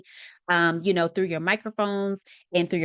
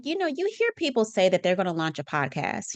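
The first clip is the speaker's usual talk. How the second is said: usual speaking voice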